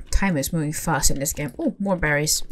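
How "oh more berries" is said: ordinary talk, nothing marked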